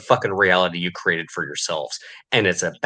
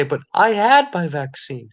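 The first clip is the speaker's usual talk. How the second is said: high, whining mimicry of a vaccinated person; mock them as a pleading, whiny victim; higher, pleading mockery of someone who followed the rules; 9 semitones higher than usual. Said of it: "and try to say".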